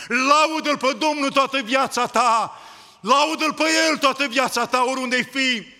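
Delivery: preacher at full cry; fervent exhortation. Real, not acted. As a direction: call them to praise with full force. Preacher's climax urging praise.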